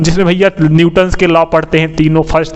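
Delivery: usual speaking voice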